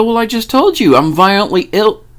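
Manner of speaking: a hammed-up exasperated snap. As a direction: spell it out like he should already know. Exasperated retort to obvious question.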